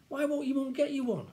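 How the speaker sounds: put-on high, loud kid voice; questioning schoolboy. Friend asks why mum refuses, insistent.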